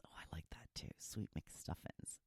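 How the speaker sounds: hushed, breathy whisper of the name; playful whisper. Pretend. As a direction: whisper it like a secret treat. Whispers a sweet turkey name.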